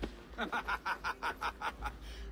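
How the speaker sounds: a warm burst of laughter at the jab; laughing off a friend's playful insult; amused laugh. Acted.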